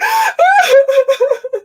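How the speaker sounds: hammed-up high wailing sob-laugh; mock crying wail. Speaker mimicking crying coach on Facebook, mock wail.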